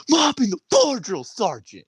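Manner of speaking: high, loud shout falling at the end; recruit sounding off. Retold or imitated. Recruit repeating order to drill sergeant, high shouted reply. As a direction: yell the order back like a recruit.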